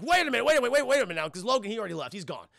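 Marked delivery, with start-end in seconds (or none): 0.0-1.3 mock eager. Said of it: "Victor Creed's like".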